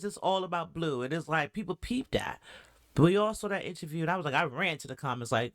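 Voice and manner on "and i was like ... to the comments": ordinary talk, nothing marked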